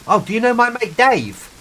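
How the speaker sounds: high-pitched mock-eager question; imitated naive civilian. Civilian asking a squaddie about his mate, mock eagerness.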